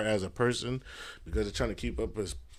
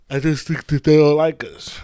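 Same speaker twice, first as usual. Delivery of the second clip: talking through a yawn; words stretched by a yawn; 6 semitones higher, 14 dB louder than usual. Real, not acted. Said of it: "I'm sorry, I'm yawning".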